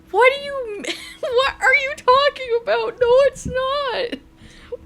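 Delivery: very high, indignant squeak; incredulous protest. Real, not acted. Streamer rejecting chat's compliment on voice changer, squeaky protest. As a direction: jump way up in disbelief.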